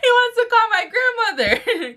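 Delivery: high, words through laughter; real laughing. Real, not acted. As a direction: talk while cracking up. Laughing while telling.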